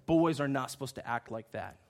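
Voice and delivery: low, softened, firm talking-to; respectful, loving correction. Retold or imitated. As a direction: take the boy aside and correct him lovingly. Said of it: "brought him aside respectfully and lovingly".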